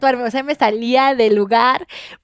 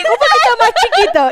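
asking for it smaller, pitched high; a light mock plea, higher; imitating the request; 10 semitones higher, 3 dB louder than usual. Siblings asking the tattoo artist for smaller dots, higher. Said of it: "Y le decían".

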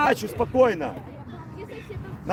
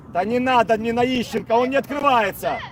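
ordinary talk

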